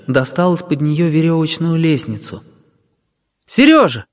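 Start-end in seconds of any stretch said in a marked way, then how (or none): 3.5-4.1 high, louder call from the kitchen. A narrator's reading. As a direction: call the boy from another room. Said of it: "позвала из кухни тётя Галя". (громкий зов из кухни)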